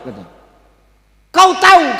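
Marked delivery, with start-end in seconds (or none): 1.3-2.0 much higher and louder cry; loud dramatic reveal. Acted. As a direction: call it out for the reveal. (seruan keras penuh emosi)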